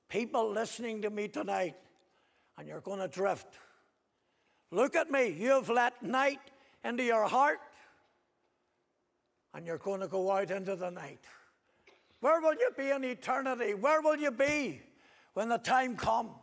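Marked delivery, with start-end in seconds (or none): 4.7-16.3 loud, high pleading preacher's cry; fiery preaching. Acted. Preacher warning the listeners about eternity, loud and high. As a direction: preach straight at them, full force.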